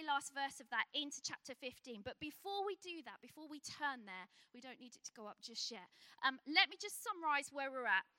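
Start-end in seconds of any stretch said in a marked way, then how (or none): none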